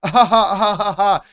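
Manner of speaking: loud, high, imitated guffaw; hammed-up derisive ha-ha; mocking laugh. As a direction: belt out a fake laugh at someone. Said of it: "He looks at me and then laughs".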